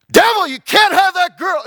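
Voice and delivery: loud, very high shouted rebuke; battle cry in prayer. Retold or imitated. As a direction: cry out against the devil. Congregation cries out in prayer.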